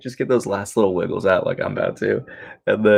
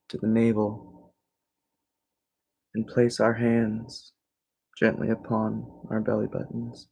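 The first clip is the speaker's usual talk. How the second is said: guided meditation; 3 semitones higher than usual. Real, not acted. Teacher shifting attention to navel and breath, calm meditation voice. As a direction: guide them calmly through each breath. Leads evening relaxation meditation.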